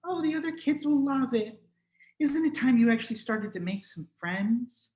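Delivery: high, smiling motherly voice; smiling mom. Acted. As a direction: sweetly nudge your shy son. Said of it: "she just smiled and said".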